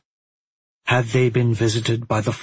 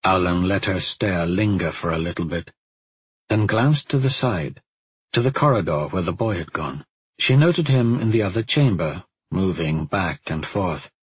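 plain reading voice

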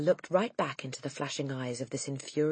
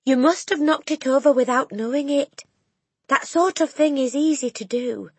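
a hint of a gentle teacher; 11 semitones higher, 8 dB louder than usual. Teacher reasoning with headmistress.

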